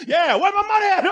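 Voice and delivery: high shouted demand; mock demand. Kangaroo shouting at the dog for money. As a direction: yell for your money.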